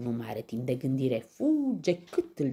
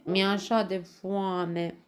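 slow, high, drawn-out hungry whine; wailing pig; 8 semitones higher, 5 dB louder, slower than usual. A narrator's reading. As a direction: whine pitifully about hunger. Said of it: "crohăie Ciuf văicărindu-se". (văicăreală tărăgănată)

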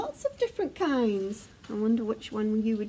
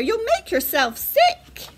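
high, louder scolding warning; crying out; 9 semitones higher, 5 dB louder than usual. A narrator's reading. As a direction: exclaim like an alarmed parent. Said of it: "cried Father Bear".

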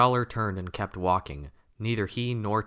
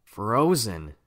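ordinary delivery

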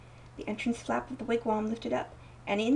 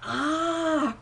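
high, louder cry of delight; wonder and delight; 5 semitones higher, 5 dB louder than usual. A narrator's reading. Sister overjoyed that the girl has seen him, delighted cry. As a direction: exclaim in wonder and joy. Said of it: "cried the sister in wonder and delight".

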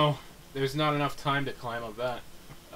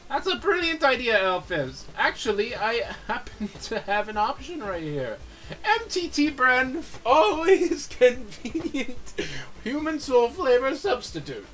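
cooking show host; 7 semitones higher, 5 dB louder than usual. Pretend. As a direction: sell it like a TV infomercial host. Host voice pitching product.

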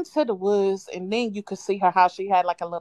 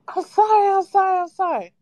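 high, louder frantic apology; pleading kid; 9 semitones higher, 5 dB louder than usual. Retold or imitated. Child begging grandma while getting whooped, high and pleading. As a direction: beg like a scared little kid.

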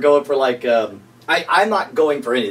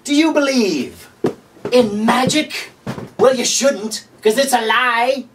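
higher, hammed-up pitchman voice with a slow question; infomercial pitchman; 8 semitones higher, slower than usual. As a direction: play the cheesy TV salesman all the way. Late night infomercial riff.

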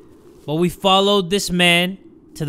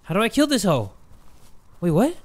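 usual speaking voice

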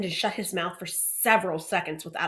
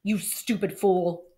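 slow, low-voiced rebuke; slower than usual. A narrator's reading. Boy scolding his friend out of the others' hearing, low voice. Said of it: "in a low voice".

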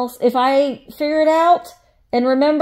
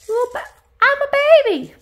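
put-on high, quick little baby voice; baby doll voice; 12 semitones higher, faster than usual. Vlogger gives the found baby doll a high quick voice. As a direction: squeak it out as the tiny doll.

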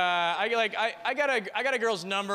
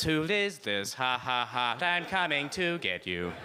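stiff robotic word-by-word delivery; automated voice message; 3 semitones lower, slower than usual. Acted. His text read to her by machine, automated voice. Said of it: "converted into an automated voice message".

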